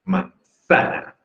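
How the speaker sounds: "Manzana" drawn out slowly, high, ZA stressed. Shown as a demonstration. Teacher showing the tonic syllable, ZA stressed in "Manzana". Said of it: "la sílaba tónica es sa".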